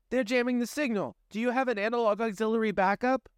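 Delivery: high, fast urgent question; urgent young woman. Acted. Pandora asks the young man for a backup, high and fast. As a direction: press him quickly.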